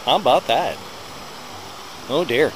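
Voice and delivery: ordinary delivery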